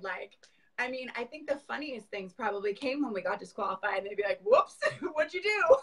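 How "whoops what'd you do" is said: high, laughing mock of cheerful parents. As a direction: play the amused parent, slow and light. Parents reacting to disqualification.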